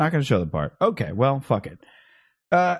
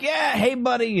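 teammate cheer; 11 semitones higher than usual. Retold or imitated. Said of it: "like, do the".

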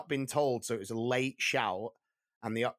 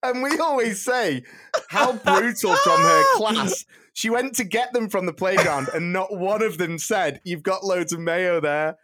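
laughing while talking, higher and louder; 7 semitones higher, 9 dB louder than usual. Real, not acted. Finishing the mayo story to friends, still laughing. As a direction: speak through giggles.

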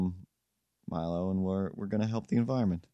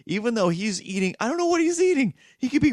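exasperated squeak; 13 semitones higher, 5 dB louder than usual. Real, not acted. Exasperated rant about the cat.

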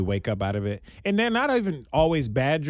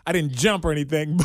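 ordinary delivery

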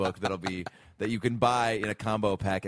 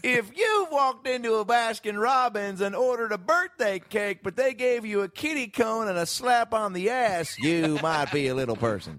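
Jeff Foxworthy; 11 semitones higher than usual. Acted. Co-host taking a turn at the game, slow impression. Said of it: "But in Jeff Foxworthy".

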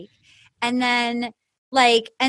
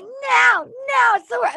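high, whiny imitation of distress; hammed-up tearful protest; mock weeping; 10 semitones higher, slower than usual. Host imitates Jamie weeping to her partner, mock distraught. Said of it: "And then she's weeping".

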